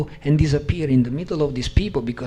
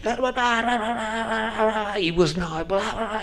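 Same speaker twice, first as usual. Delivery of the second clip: higher mock gibberish of an upset speech; mock upset discourse; 7 semitones higher than usual. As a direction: parody a language you can't follow. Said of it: "it sounds kind of, you know, upset".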